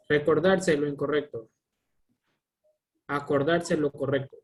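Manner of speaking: ordinary talk, nothing marked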